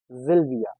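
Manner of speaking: Sylvia said "ZIL-via"; S of Sylvia sounded as z; German pronunciation. Shown as a demonstration. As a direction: start Sylvia with a buzzing z. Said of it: "S sounds as".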